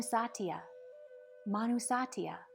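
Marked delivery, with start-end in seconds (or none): none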